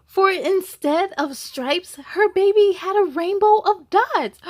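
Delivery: put-on reading to children. A narrator's reading. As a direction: tell it brightly to the little ones. Reads the picture book aloud.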